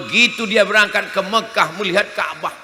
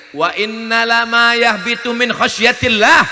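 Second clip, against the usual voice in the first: loud, higher recited Arabic verse; Quran recitation; 6 semitones higher, 6 dB louder than usual. Acted. Preacher reciting scripture to the congregation, loudly proclaimed.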